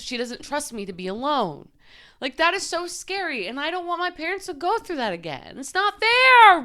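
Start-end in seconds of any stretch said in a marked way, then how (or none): 3.9-6.6 tearful outburst. Real, not acted. About her parents suffering, a loud tearful cry. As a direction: let it burst out through tears.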